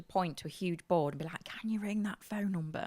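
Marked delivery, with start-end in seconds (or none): 1.4-2.9 mouthed through the glass; whisper. Retold or imitated. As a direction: silently mouth each word. Mouthing through car window.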